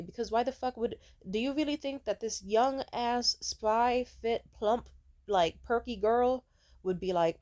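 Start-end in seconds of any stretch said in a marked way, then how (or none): none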